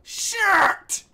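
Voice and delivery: loud high frustrated yell. Real, not acted. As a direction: scream it out in frustration. Frustrated yelling.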